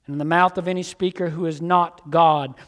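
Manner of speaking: normal talking voice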